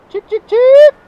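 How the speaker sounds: loud high "chick chick chick" call; calling chickens. Real, not acted. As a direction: call the stray chick from far off. Calling a silkie from afar.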